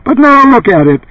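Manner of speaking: very high brassy sales cry; brassy bellowing housewife. A narrator's reading. Housewife showing off the refrigerator, high brassy bellow. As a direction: show it off to the viewers. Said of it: "the housewife continued".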